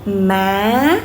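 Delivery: "Ma" gliding up sharply at the end; rising tone. Shown as a demonstration. Teacher demonstrating the second tone, rising "Ma". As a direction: start "Ma" level, then lift it like a question. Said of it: "raise your voice a little bit".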